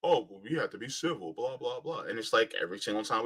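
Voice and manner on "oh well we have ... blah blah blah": mocking quote. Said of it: "we were constantly told".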